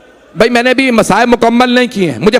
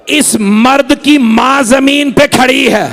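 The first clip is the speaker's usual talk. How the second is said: wailing lament; slower than usual. Acted. Abbas telling Husain of the mother on the ground, wailing. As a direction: cry it out, slow and stretched.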